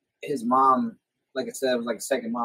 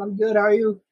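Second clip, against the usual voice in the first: higher, put-on politeness; re-enacted polite reply; 8 semitones higher, 4 dB louder than usual. Visitor chats with friend's mom through the wall, polite. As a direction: call back politely to a friend's mother.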